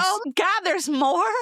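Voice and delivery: high, aghast; dismayed. Real, not acted. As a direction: recoil at the news.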